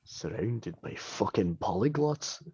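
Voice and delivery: slow, low dwarf grumble; exasperated dwarf under his breath; Bragg. Acted. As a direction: mutter it deep, softly and fed up. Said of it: "Bragg just looks around the party, is like".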